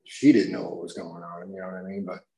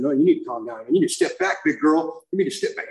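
rising, louder standoff with an agent; voice going up; 5 semitones higher, 3 dB louder than usual. Retold or imitated. Man squaring up to an airport agent, voice climbing. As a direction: get higher as you argue back. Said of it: "my voice started going up".

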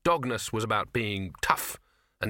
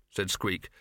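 ordinary delivery, nothing marked

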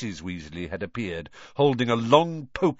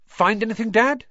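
high, louder eager question; 13 semitones higher, 7 dB louder than usual. Acted. Fred eagerly asking his tired father, high and keen. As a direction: ask keenly, leaning in. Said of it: "said Fred eagerly".

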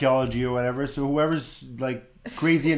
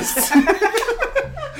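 high burst of laughter. Real, not acted. Laughing after accent bit.

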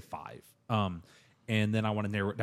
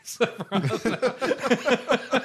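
high, slow words dissolving into laughter; breaking up laughing; 11 semitones higher, 5 dB louder, much slower than usual. Real, not acted. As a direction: crack up halfway through the sentence. Breaks up laughing telling own choice.

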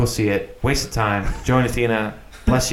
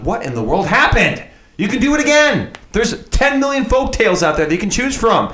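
high-pitched incredulous rant; exasperated outburst; 10 semitones higher than usual. Acted. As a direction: burst upward in mock disbelief. Mock outburst at studio's remake habit.

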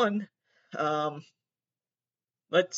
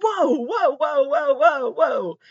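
high, louder repeated whoa; incredulous high whoa whoa whoa; startled whoa; 9 semitones higher, 7 dB louder, much slower than usual. Real, not acted. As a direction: back off in alarm, high and loud. Reacting to the question.

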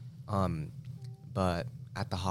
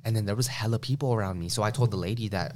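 ordinary delivery

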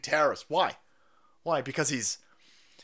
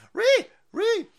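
mock shriek; 7 dB louder than usual. Mocking shriek.

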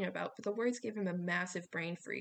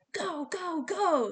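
urgent yell; 9 semitones higher, 7 dB louder, much slower than usual. A narrator's reading. Coach urging boys in storm.

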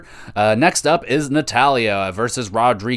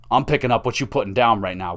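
ordinary talk

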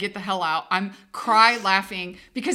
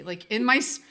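normal talking voice

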